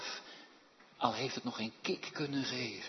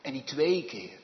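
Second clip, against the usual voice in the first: ordinary delivery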